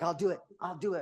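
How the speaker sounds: high, loud repeated threat; imitated threat. Husband imitating his wife threatening to stab him, threatening. Acts out her knife threat.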